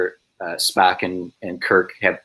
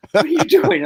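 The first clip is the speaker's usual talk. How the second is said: very high panicked question; mock alarm; 4 dB louder than usual. Neighbouring extra reacting to the blunder, high mock alarm. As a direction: squeeze out an alarmed question, pitch way up.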